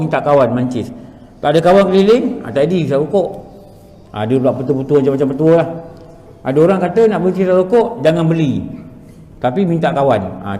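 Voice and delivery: usual speaking voice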